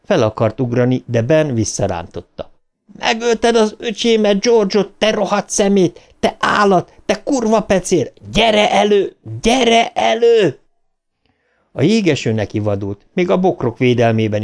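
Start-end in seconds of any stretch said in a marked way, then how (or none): 3.0-10.6 very high-pitched furious tirade; high, sustained angry cry; enraged yelling. Acted. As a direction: hurl accusations, stretching the final challenge. Enraged boy challenges brother's killer.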